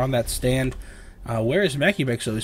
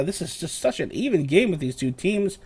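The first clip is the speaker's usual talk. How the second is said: ordinary talk, nothing marked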